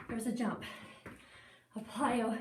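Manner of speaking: ordinary talk, nothing marked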